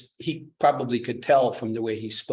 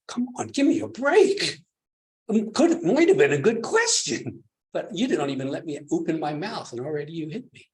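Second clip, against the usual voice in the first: indignant monk; 5 semitones higher, 4 dB louder than usual. Acted. Monk voiced complaining, high.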